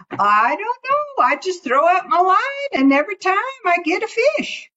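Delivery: high, lightly voiced rabbit; breezy high rabbit shrugging it off; Brer Rabbit. Rabbit voiced in folk tale.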